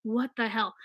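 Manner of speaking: soft, stunned mock panic; hushed, shaken disbelief; startled dread. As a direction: rider caught off guard by the dip, quietly shocked. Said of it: "you're just like".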